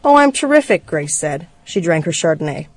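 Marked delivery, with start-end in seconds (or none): 0.0-0.9 high-pitched sarcastic brightness; falsely cheerful. Acted. Wife answering husband over tense dinner, falsely cheerful. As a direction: pretend all is great, pitched way up.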